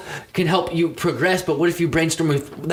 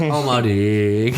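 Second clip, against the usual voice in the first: slow drawn-out tag; 4 semitones lower, much slower than usual. Pretend. Rap line repeated, drawn out.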